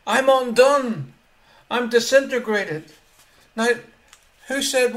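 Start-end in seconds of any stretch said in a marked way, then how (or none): 0.1-2.9 raised, louder cry of dramatic despair; acted despair. Preacher voicing Isaiah undone before God, acted despair. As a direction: declare your ruin in a high, heavy voice. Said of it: "absolute despair".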